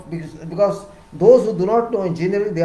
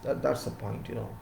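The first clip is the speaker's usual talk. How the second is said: ordinary talk, nothing marked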